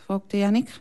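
plain reading voice

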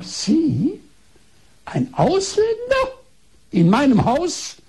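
high, drawn-out mock astonishment; imitated indignant disbelief. Doctor to foreign patient at her house, indignant disbelief.

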